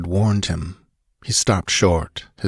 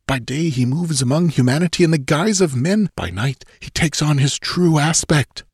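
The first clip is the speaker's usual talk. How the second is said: high-pitched, fearful recounting; horrified young nobleman; 9 semitones higher than usual. A narrator's reading. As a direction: relive the horror in a high voice. Murilo explains his suspicion to Conan.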